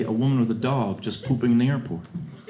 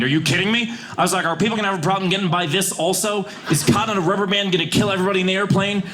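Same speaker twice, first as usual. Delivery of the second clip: raised, exasperated disbelief; indignant rant; 7 semitones higher than usual. Retold or imitated. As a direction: rant at her like it's absurd. Comic rant at flight attendant.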